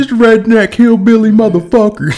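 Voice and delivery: high, loud sneering voice; mock heckler. Friend voicing an online troll, high and loud. Joining the gamer taunt.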